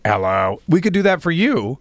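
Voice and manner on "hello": low monotone electrolarynx voice; robot voice. Retold or imitated. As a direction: voice box robot hello. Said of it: "it talks like a robot for them".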